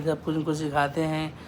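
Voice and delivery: ordinary talk, nothing marked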